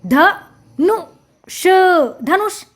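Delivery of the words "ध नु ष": ध, नु, ष as three separate sounds; sounding out. Shown as a demonstration. Teacher spelling out a word for children, sound by sound. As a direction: break it up with pauses: ध... नु... ष. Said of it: "हमने इसका उच्चारण किया".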